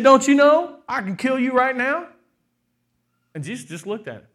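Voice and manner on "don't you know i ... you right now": loud, high, menacing challenge; threatening Pilate. Retold or imitated. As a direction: bark it at him like an angry governor. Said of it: "He said".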